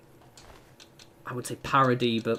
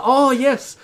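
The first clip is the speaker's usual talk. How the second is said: much higher and louder, bright sudden relief; French waiter relieved to switch to English; relieved surprise; 11 semitones higher, 6 dB louder than usual. Retold or imitated. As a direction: burst out with relief at hearing English. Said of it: "they're like".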